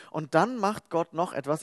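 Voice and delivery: normal talking voice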